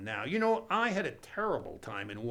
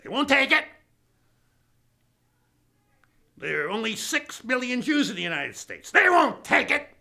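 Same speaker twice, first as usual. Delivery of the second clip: loud, higher, hammed-up outrage; outraged rant; 8 semitones higher, 7 dB louder than usual. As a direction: rail against it like a spoiled tycoon. Billionaire ranting loudly.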